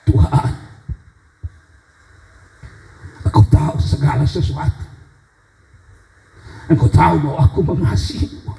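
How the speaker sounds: halting, tearful confession with long pauses; deeply moved. Acted. Petrus confessing love to Tuhan, deeply moved. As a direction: plead from a full heart, rising at the end. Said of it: "dengan nada terharu".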